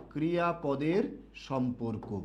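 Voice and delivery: slow, word by word for students to write; slow dictation. Shown as a demonstration. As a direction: dictate it slowly so they can copy. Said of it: "একটু লিখবেন সবাই". (ধীরে ধীরে শ্রুতলিখন)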